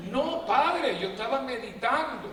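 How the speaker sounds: high, loud mock excuse; mocking the woman. Dozing woman denying to the priest, high mock protest. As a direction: play the caught woman's whiny excuse.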